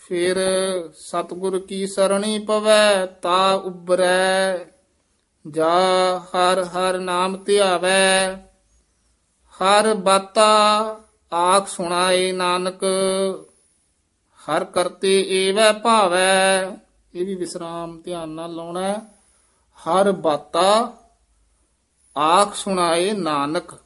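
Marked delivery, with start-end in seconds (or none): 0.1-16.8 measured chant-like reading with drawn-out line endings; scripture recitation. Shown as a demonstration. Teacher reciting Gurbani for students, measured chant. 19.8-23.7 measured phrase, ਬਾਤਾ set apart and a stop after Nanak; rest after Nanak. Shown as a demonstration. Teacher showing students the rest after Nanak, measured reading. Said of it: "ਇੱਥੇ ਵਿਸਰਾਮ ਲਾਉਣਾ ਹੈ".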